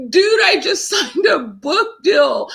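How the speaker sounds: giddy, breaking into laughter mid-line; bursting with joy, laughing through it; laughing excitedly. Retold or imitated. Retelling calling best friend, elated.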